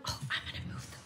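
hushed, voiceless aside; real soft whisper. Real, not acted. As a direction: whisper it softly, as if they might hear. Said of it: "no idea why I'm whispering".

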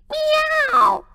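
high, drawn-out meow howl; a cat's high wailing meow; miserable cat howl. A narrator's reading. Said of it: "he howled miserably".

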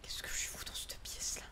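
hushed scared whisper to herself. Real, not acted. Scared player whispering to herself in the dark room. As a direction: breathe it out, afraid to be heard.

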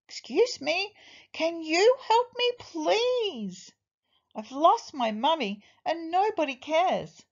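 put-on high-pitched, upset lost child; worried little one begging for help; worried pleading. A narrator's reading. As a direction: plead anxiously, voice pitched well up. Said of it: "getting really worried now".

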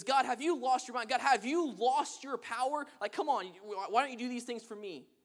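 loud, outraged complaint to God; exasperated shout. Retold or imitated. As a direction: throw the complaint at God, indignant. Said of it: "He goes".

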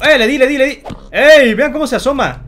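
high, loud bursts; excited exclaiming. Real, not acted. Gamer shows viewers the pig peeking, excited. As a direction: whoop over a lucky hit.

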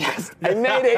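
high, laughing joy at winning; excited. Retold or imitated. Director retelling his award win, laughing with joy. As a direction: squeal it out like you just won. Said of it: "I was like".

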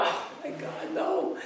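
a half-laughing cry of horror; mock horrified cry. Brahmin sees Nimai eat the rice again, high horrified cry. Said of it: "And go".

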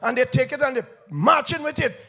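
loud, high, sing-song preaching with rising fire; full force preaching. Acted. Preacher warns the church against cheap grace, shouting. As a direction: thunder it out to the congregation.

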